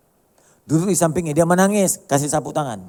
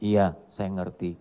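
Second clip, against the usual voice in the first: slow, lower and softer reassurance; sit beside the mourner and speak softly, slowly; slow gentle comfort; 10 semitones lower, 6 dB quieter, much slower than usual. Shown as a demonstration. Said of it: "Anda coba bilang".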